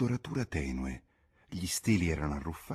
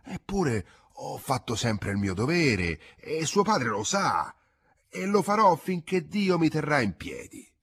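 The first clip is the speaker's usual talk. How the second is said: slow and hurt, building to a higher, louder proud declaration; growing arrogance; 7 semitones higher, 4 dB louder than usual. A narrator's reading. Farmhand defending his duty to the boy, swelling into arrogance. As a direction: swell from grievance into defiance. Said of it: "E allora la sua voce diventava quasi arrogante".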